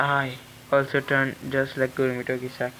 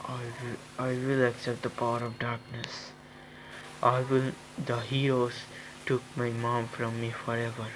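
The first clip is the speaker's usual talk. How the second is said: grim quiet vow; 3 semitones lower, 6 dB quieter than usual. Acted. Accepting power of darkness.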